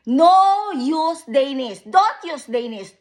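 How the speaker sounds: slow, loud, high protest; emphatic demand. Real, not acted. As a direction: spell out each word as a demand. Demanding they not use Danish.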